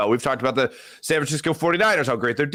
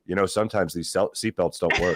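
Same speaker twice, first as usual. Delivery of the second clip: ominous stranger; 8 semitones lower than usual. Acted. Stranger to nervous roller coaster rider, ominously low-key. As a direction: deliver unsettling news as a low, casual aside.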